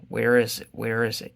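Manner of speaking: ordinary delivery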